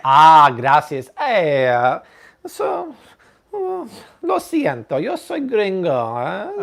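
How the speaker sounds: hammed-up gringo Spanish, slowed and pitched high; mock a clumsy gringo accent; bad Spanish accent. Host thanking Spanish-speaking viewers in a bad Spanish accent. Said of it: "enough with the bad accents".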